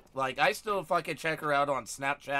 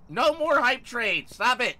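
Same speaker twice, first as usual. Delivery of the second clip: yelling; 6 semitones higher, 4 dB louder than usual. Real, not acted. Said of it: "I'm gonna stop yelling".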